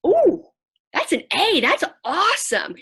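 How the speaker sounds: high, loud, bright praise; light up over the good grade; excited mom cheering a test score; delighted mom. Retold or imitated. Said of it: "I'll start to say".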